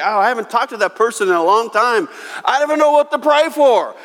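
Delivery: rising disbelief at the name; baffled exclamation; surprised thought. Retold or imitated.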